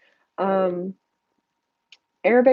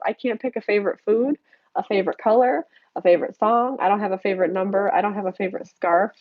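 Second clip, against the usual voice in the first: normal talking voice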